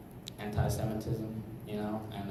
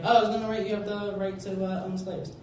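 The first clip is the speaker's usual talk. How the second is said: higher, fast, breezy confession; casual Jefferson; 9 semitones higher than usual. Retold or imitated. Comic imitating Jefferson, breezily admitting. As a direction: toss it off like nothing. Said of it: "He's like".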